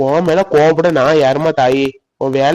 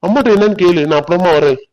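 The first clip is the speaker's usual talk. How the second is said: normal talking voice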